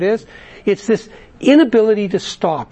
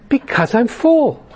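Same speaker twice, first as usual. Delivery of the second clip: wife voice; 7 semitones higher, slower than usual. Retold or imitated. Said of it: "she said".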